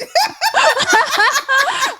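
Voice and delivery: very high, loud laughing fit; big laugh. Real, not acted. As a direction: burst out laughing. Laughing at [name] joke.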